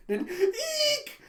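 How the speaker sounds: squeaky high eek, slowed and laughing. Retold or imitated. Speaker mimicking Yor's panicked squeal, high and laughing.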